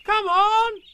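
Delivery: high, loud drawn-out call; ringing boyish shout from afar. A narrator's reading. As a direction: holler down the line to start everyone off. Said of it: "There was a shout".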